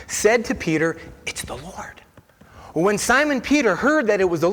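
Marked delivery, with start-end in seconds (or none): 1.3-2.0 whispered in awe; awed whisper. Acted. Disciple telling Peter who stands on shore, awed whisper. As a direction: breathe it out in wonder.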